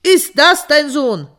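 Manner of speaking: höher und lauter, anklagend; empörter König. A narrator's reading. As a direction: fassungslos anklagen. Wütender König fragt nach. (der König fährt den Bauern zornig an, laut)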